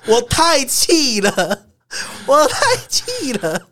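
outraged but laughing it off; laughing fury. Real, not acted. (又笑又气地拉长说)

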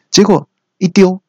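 ordinary talk, nothing marked

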